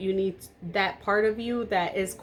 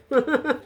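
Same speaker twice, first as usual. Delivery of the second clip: short, higher-pitched chuckle; amused laugh; 6 semitones higher, 3 dB louder than usual. Real, not acted. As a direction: chuckle at your own joke. Laughs after joking about being loud.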